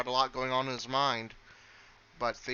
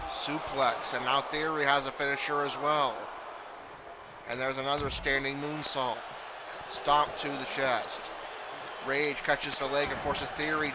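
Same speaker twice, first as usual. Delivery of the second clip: energetic live wrestling call, a bit louder; play-by-play commentary; 2 semitones higher, 3 dB louder than usual. Acted. Commentator calling the match for viewers, energetic play-by-play. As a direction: call the match as it happens, lifting on big moves.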